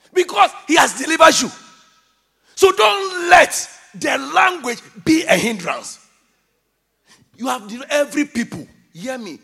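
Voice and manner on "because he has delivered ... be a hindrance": loud, high-pitched exhortation; forceful preaching. Real, not acted. Preacher urging listeners past the language hindrance, loud and forceful. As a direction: proclaim it to the whole congregation.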